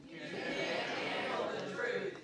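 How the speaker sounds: limp, breathy, slow line; weak Nicholson impression. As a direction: deliver it feebly, no punch. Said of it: "That was pretty weak, [name]".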